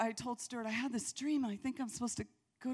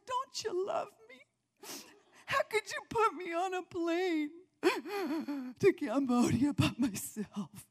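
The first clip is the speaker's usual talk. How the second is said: much higher, wailing mock cry; mock tearful protest; 6 semitones higher than usual. Wife wailing at husband at the airport, mock crying. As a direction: wail at your husband, hammed-up. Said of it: "I'm looking at Stuart".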